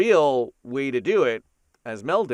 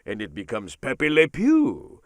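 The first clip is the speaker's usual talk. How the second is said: put-on French accent; 3 dB louder than usual. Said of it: "add a French accent".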